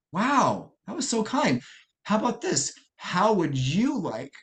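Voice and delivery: high, delighted praise with a slowing, tender ask; impressed praise. Retold or imitated. Youth leader praising the selfless little girl, high and delighted. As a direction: gush over the child's kindness.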